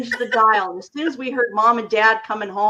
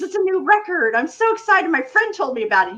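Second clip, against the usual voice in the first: put-on higher, eager kid gushing; excited kid; 7 semitones higher than usual. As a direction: gush like a thrilled child showing off a record. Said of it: "I says".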